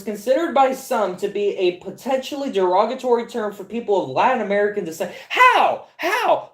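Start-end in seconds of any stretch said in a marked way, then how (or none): 5.3-6.5 high, loud, laughing "How?"; incredulous yelp. Real, not acted. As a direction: yelp it out in shock. Outburst of disbelief.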